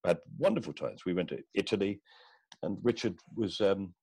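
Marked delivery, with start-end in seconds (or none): none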